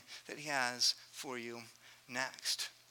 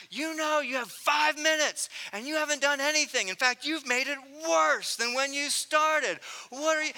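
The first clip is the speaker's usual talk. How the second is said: higher and louder, fed up; exasperated dad; 8 semitones higher, 5 dB louder than usual. Retold or imitated. Dad yelling at wrestling boys, exasperated and loud. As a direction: scold them, patience gone. Dad losing patience at bedtime.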